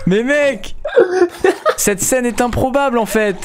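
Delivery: high, laughing disbelief; excited laughing. Real, not acted. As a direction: gush about the absurd scene. Amazed at zombie with bee. (rire excité et aigu)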